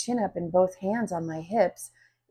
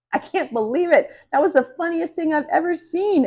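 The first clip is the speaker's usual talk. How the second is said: excited; 10 semitones higher, 5 dB louder than usual. Acted. Friend delighted by the chaos.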